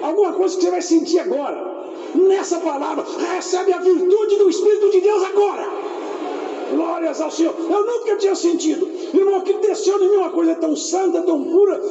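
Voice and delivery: fervent revival preaching; full-force preaching. Acted. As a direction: preach it up to the rafters.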